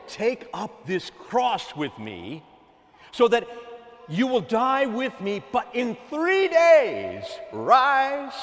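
fervent preacher's shout as the Lord; preaching shout. Acted. Preacher voicing the Lord's words.

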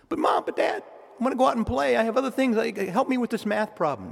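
put-on high pleading kid voice; whiny child voice. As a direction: whine like a kid begging. Child voice whining to parents.

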